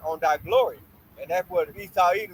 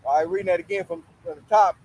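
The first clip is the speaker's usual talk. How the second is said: ordinary talk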